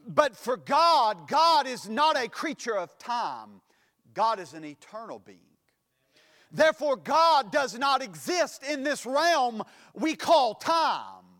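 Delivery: loud, drawn-out pulpit declaration; full-force preaching. Real, not acted. Preacher tells the congregation of God and time, preaching forcefully.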